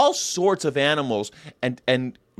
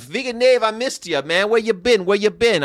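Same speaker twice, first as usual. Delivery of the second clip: high-pitched, eager welcome; happy, high-voiced hello; excited greeting; 7 semitones higher than usual. Real, not acted. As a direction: greet a missed friend in a raised pitch. Greeting a returning regular viewer.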